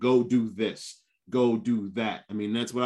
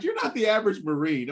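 impressed onlooker voice; 10 semitones higher than usual. Retold or imitated. People greeting him on arrival.

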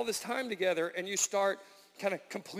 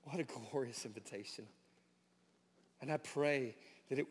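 prayer; 3 semitones lower than usual. Acted. Pastor prays before sermon.

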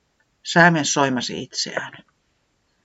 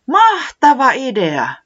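high, loud and slow, thrilled; excited exclamation; 11 semitones higher, 6 dB louder, slower than usual. Acted. Säämies cheering the winter party idea, high and loud. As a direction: shout it out with delight. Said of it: "säämies huudahti".

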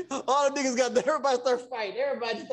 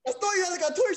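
ordinary delivery

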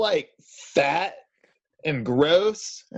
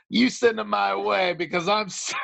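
usual speaking voice

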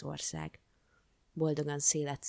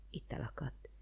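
plain reading voice

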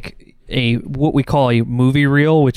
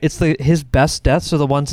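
ordinary delivery

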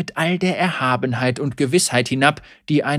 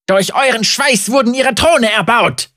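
high, loud, furious accusation; hissing anger; 9 semitones higher, 8 dB louder than usual. Acted. Heretic hisses at the crowd, high and loud. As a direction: spit the words out in rage. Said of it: "fauchte er".

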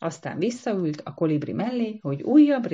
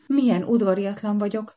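a lightly raised, higher exclamation; exclaiming; 5 semitones higher, 4 dB louder than usual. A narrator's reading. Mild exclamation to the guest, higher. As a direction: Antika cries out in embarrassment. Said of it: "kiáltott fel".

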